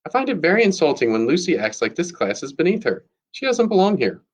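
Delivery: high-pitched, offended schoolgirl protest; indignant complaint. A narrator's reading. Maddie keeps talking against Lucy in class, indignant.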